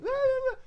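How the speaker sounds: high mock wail in short bursts; loud, hammed-up high crying; imitated wailing. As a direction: imitate someone wailing in the background. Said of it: "I hear wailing in the background".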